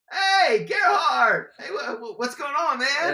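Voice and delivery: mock-excited employee greeting the caller. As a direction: shout the welcome like an old friend calling. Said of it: "They go".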